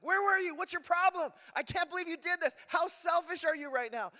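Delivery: high, loud blaming outburst; accusing. Shown as a demonstration. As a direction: demo the wrong way, all accusation. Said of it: "instead of using the word like".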